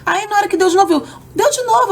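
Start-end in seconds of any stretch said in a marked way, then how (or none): 1.3-1.9 high, urgent alarm at a new attack; panicked cry. Retold or imitated. Patient telling the doctor the attack is back, panicked high cry.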